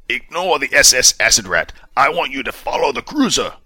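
clipped commanding officer tone; hint of a stern commander demanding answers; commander Justice, lightly voiced. A narrator's reading. Commander orders.

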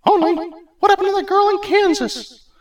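very high, loud goddess; suspicious Hera. Acted. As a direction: challenge his claim.

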